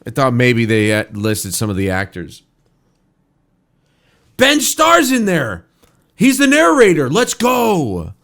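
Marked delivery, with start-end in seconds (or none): 4.4-8.1 higher, louder, thrilled at a familiar name; real excitement over the credits; excited. Real, not acted. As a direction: cheer it out like spotting a friend. Spots a favourite actor in credits.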